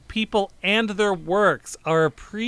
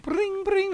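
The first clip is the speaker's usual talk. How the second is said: imitated harp that rings like a phone; mouth-made ringing sound; 6 dB quieter than usual. Recalling the sketch's opening effect, imitated ringing. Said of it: "it just is like a phone".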